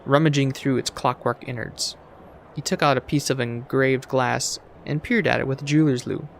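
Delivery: ordinary talk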